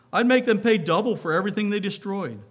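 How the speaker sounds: fervent. Acted. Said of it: "with fervor".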